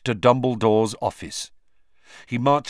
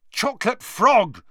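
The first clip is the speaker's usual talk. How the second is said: loud, much higher angry yell; 14 semitones higher, 6 dB louder than usual. A narrator's reading. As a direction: shout it at the gargoyle. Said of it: "he yelled angrily".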